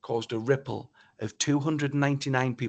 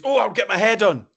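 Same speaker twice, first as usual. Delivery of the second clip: eager reply; 6 semitones higher, 8 dB louder than usual. Retold or imitated. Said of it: "The first thing she said is".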